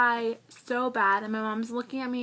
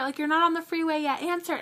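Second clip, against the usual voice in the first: higher, eager pushy friend; insisting friend; 5 semitones higher, faster than usual. Retold or imitated. Friend urging the driver to answer the phone, higher. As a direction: keep nagging her to pick up. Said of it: "she's like".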